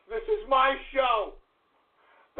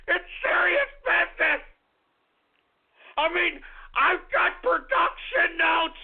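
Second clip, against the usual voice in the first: tearful wail; 2 semitones higher than usual. Acted. Host wails that his show is serious, tearful wail.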